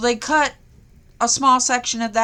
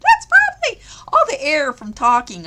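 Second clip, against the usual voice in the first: laughing through hiccups; giggling while talking; 5 semitones higher, 6 dB louder than usual. Real, not acted. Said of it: "I don't know".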